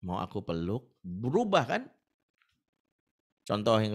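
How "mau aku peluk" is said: tender comfort. Retold or imitated. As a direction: soothe her like you mean it. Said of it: "bisa nggak kita ubah gininya".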